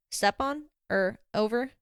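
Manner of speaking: hesitant echo, both "on" and "over" rising; puzzled questioning. Acted. Jazz unsure of radio protocol, puzzled and hesitant. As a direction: query "Step on", then a doubtful rising "over".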